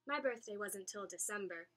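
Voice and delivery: usual speaking voice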